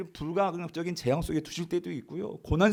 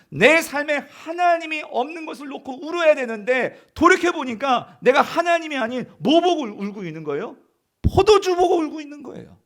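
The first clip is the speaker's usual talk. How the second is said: loud, higher impassioned preaching; preaching at full force; 5 semitones higher, 8 dB louder than usual. Real, not acted. Preacher pressing the congregation on what they weep for, impassioned. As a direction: drive the point home with fervor.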